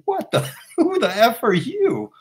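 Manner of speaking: high-pitched, indignant disbelief, half-laughing; mock-outraged who-do-you-think-you-are; incredulous ranger. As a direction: scoff in disbelief as you imitate him, voice shooting up. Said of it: "He looks at me like".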